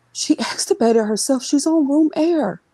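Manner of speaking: telling mom the unbelievable news; excited news. Retold or imitated. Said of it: "I said".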